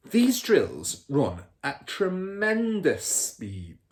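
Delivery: put-on a smug car dealer bragging to his kid; Mr Wormwood's voice. A narrator's reading. Father character voice.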